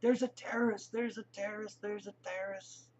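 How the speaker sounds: panicked yell that fades each time; retold screaming. Lady spotting a bearded man, a mild mock yell fading away. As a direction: cry out in alarm, then fade off. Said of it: "instantly started screaming".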